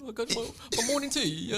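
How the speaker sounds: put-on high, fancy London accent. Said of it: "That's your London accent".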